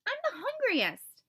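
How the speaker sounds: high, insistent kitten argument; arguing. A narrator's reading. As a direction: argue for the egg. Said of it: "Lottie argued".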